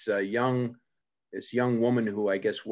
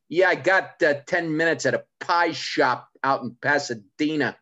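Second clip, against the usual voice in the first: John DiResta; 5 semitones higher, 5 dB louder than usual. Retold or imitated. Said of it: "John's gigs. It's like".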